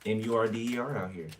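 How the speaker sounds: M U R D E R spelled letter by letter. Shown as a demonstration. Reader warning of danger, spelling M U R D E R letter by letter. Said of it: "There's an attempted".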